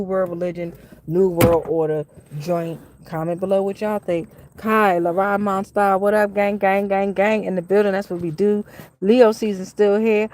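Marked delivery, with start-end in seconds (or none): none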